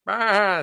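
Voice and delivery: high, loud bleating baa; sheep's baa. Retold or imitated. Sheep joining the chorus, high loud bleat. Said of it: "said the sheep".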